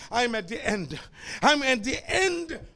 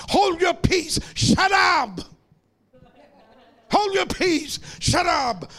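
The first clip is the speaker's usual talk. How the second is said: commanding shout; 6 semitones higher than usual. Acted. Preacher commanding congregation.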